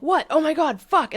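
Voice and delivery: high, loud, startled outburst; mock freak-out. Coach showing how not to engage with emotion, mock freak-out. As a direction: overreact in mock horror. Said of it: "instead of being like".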